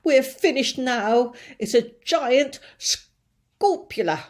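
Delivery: loud, slow despairing fire chief; panicky fire chief. Acted. Fire chief despairing over the giant monster. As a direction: wail it as if all is lost.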